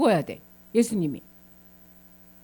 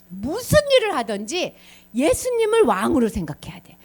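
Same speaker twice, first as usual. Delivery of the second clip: usual speaking voice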